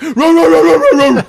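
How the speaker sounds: loud, high mock barking; dogs barking. Guard dogs bark at the girls, loud and high. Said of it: "the dogs are like".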